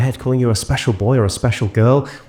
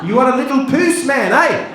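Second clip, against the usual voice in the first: high, brash South African heckler; South African man heckling. Retold or imitated. The dog's South African owner heckles the Englishman, brash. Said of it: "being like".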